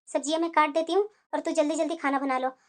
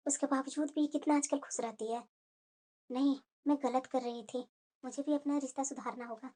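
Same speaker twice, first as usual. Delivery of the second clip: soft, low thinking-aloud voice; quiet self-reproach under the breath; inner thoughts; 3 semitones lower, 9 dB quieter than usual. Acted. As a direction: murmur it to yourself, unheard by others. Mother-in-law thinking to herself.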